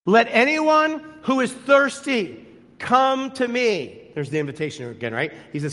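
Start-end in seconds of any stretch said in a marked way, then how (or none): 0.0-4.1 higher, louder, slow ringing call; loud proclamation. Acted. Jesus calling out to the festival crowd, loud slow proclamation. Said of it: "said in a loud voice".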